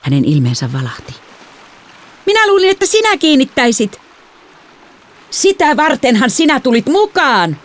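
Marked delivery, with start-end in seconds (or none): 2.3-7.6 high, heated yelling; exasperated high-pitched shout; angry shouting. A narrator's reading. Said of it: "minä huusin".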